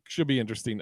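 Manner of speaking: ordinary delivery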